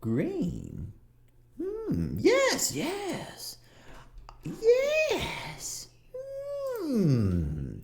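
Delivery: put-on high, musing old shop woman; shopkeeper woman. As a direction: ponder the order in a high, fussy voice. GM voicing the shopkeeper.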